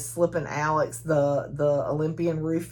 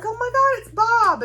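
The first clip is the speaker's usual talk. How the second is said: high, loud gasp of recognition; surprised; 6 dB louder than usual. Retold or imitated. Josie recognizes her childhood friend, high surprised squeal. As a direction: squeal like you've just spotted an old friend. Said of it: "she's like".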